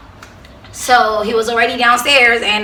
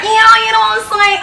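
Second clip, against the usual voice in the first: high-pitched gleeful taunt; 9 semitones higher, faster than usual. Retold or imitated. Enjoying angry passengers' glares, gleeful and high. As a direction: act smugly happy, voice lifted. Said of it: "I was so happy".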